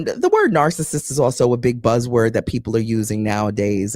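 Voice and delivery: usual speaking voice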